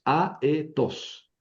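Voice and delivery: A, e, tos said as three separate syllables. Shown as a demonstration. Teacher repeats the aetos syllables for the class, separated. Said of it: "Tres sílabas".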